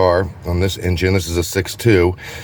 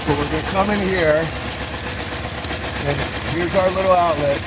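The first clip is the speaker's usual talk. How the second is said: shouting over engine; 6 semitones higher, 4 dB quieter than usual. Real, not acted. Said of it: "I hope you can hear me over the engine".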